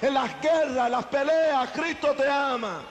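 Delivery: high, lilting preacher's refrain; mock the preacher's sweet chant; mimicking preacher. Ex-gang leader mocking the skinny preacher, lilting high.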